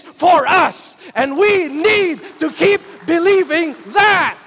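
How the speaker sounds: loud, rising sermon, building to shouts; fiery preaching. Acted. Preacher urging the congregation to keep believing, full force. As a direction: preach it hard and drive it home to the congregation.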